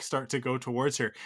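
ordinary delivery

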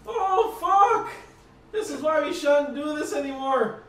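frantic high shouts for a medic; panicked yelling. Acted. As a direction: scream for help in a panic. GM voices panicked arena NPC.

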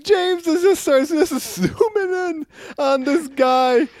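slow, high, amused; laughing; laughing while talking. Real, not acted. Describing panel while laughing.

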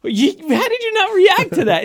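high-pitched disbelief, retold; incredulous. Telling co-host how he questioned her, incredulous. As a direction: re-enact your stunned question, pitched high. Retelling his reaction to her.

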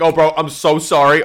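high, loud, flustered sorry; mock panicked apology. Driver to stranger after a wrong turn, exaggerated panic.

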